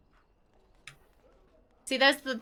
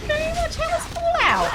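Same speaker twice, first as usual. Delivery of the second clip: high-pitched; 6 dB louder than usual. Real, not acted. High-pitched question to donor.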